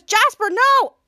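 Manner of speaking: high, loud imitated warning; alarmed shout. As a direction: cry out to stop him. Retold shout to stop Jasper.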